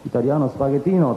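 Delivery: hammed-up fake Italian, lightly done; mock Italian. Joking about Italian word endings in Rome, mock Italian. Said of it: "全部最後にノーがつきまして".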